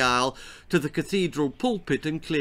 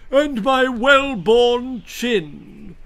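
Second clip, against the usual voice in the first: high, loud, slow gush; gushing marquis; 10 semitones higher, 8 dB louder, much slower than usual. A narrator's reading. Marquis admiring the baby, gushing loudly. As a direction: gush over your own noble chin. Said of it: "gushed the Marquis of Boot".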